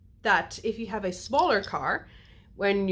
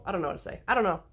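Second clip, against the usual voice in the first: ordinary talk, nothing marked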